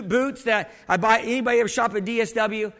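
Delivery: normal talking voice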